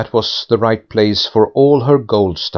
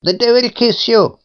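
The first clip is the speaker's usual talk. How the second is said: put-on much higher, rude scornful girl; spiteful insult; 12 semitones higher than usual. A narrator's reading. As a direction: spit the insult at the head. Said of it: "said the girl".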